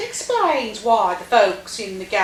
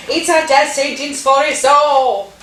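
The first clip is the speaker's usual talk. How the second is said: loud mock wail, only slightly higher; retold banshee scream; 3 semitones higher, 6 dB louder than usual. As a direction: imitate the screaming wife, half-strength. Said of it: "the man's wife screams like a banshee".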